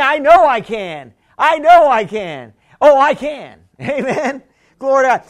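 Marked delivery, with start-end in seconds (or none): 0.0-3.6 "I know I can" said louder, "know" stressed; louder declaration. Shown as a demonstration. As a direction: declare it with full conviction. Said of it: "And then".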